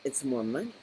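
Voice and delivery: ordinary talk, nothing marked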